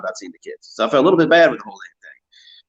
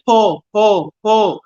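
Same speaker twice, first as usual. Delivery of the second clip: put-on high, louder child calling Paul; son's high call, copying Creaney; child's Paul; 10 semitones higher, 5 dB louder, much slower than usual. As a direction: pitch it up like a toddler calling. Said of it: "he'd say the exact same way Creaney said it".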